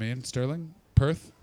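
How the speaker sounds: ordinary talk, nothing marked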